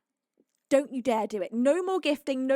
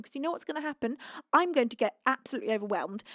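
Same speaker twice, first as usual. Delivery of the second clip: ordinary delivery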